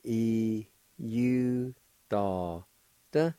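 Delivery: "E, U, da, da" as separate held syllables; syllable-by-syllable demo. Shown as a demonstration. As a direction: sound out "E, U, da, da" with clear gaps. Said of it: "The second part".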